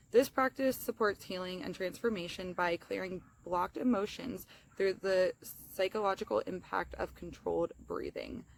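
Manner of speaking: normal talking voice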